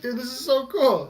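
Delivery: giddy excitement. Retold or imitated. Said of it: "you smile, like".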